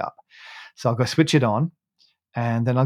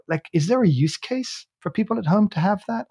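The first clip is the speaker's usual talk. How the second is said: ordinary talk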